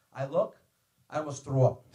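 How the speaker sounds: ordinary delivery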